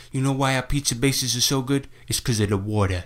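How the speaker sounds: thick-accented Brooklyn local, retold. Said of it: "She had the proper accent".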